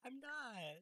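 high, squeaky protest; flustered denial. Real, not acted. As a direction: deny it all flustered.

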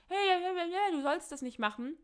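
"hey" calls pitched high, then settling back down; the eating disorder yelling at you to stop; eating disorder's voice. Acted. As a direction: voice the nagging inner illness shouting you down. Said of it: "Wenn die Essstörung laut schreit und sagt".

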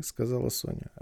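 plain reading voice

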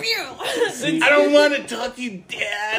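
high, slow laughing whine; hammed-up sulky teenager; whiny teen.

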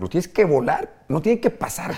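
mock stern coaching. Father drilling his defender son.

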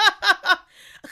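sudden amused laugh; loud laugh. Real, not acted. Laughs at article jab.

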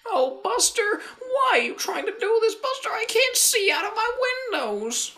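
mock Buster voice. Co-host joining the bit in a very high silly voice. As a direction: squeak it out in a silly falsetto.

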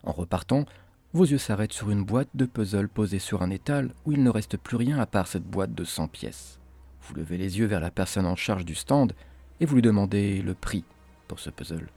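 ordinary delivery, nothing marked

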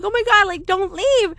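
high-pitched retold panic; panicked plea. Child begs toddler not to leave, acted high panicked plea. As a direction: act out begging, pitch jumping up. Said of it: "Like, I started panicking".

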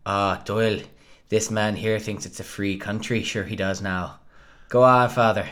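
a light mock Irish accent, quoting the film; lightly put-on Irish lilt, film thug; imitating Irish accent. Friend quotes a movie thug to his co-host, mock Irish accent. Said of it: "does an Irish accent in that movie".

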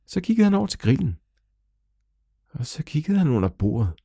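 usual speaking voice

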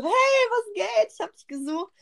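high, slow, put-on cheery hello; fake casual greeting. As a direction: sing it out like nothing's wrong. (hoch und langsam gedehnt, gespielt lässige Begrüßung)

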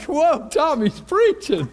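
drawn-out astonishment; amazed. Retold or imitated. Amazed at Tommy preaching.

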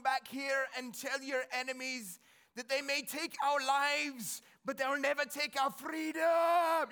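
bad Scottish accent battle speech, building to a shout; William Wallace. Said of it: "please forgive my bad Scottish accent".

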